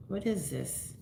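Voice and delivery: softly wondering to yourself; quiet, absent-minded question; puzzled self-talk. Real, not acted. Puzzled, talking to herself over object.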